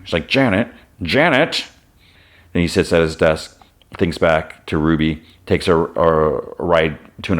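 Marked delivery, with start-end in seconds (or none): 0.3-1.7 higher, slow call after someone leaving; calling after her. Retold or imitated. Ben calling after Janet as she walks out, slow and higher. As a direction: call her name twice, drawn out and pitched up.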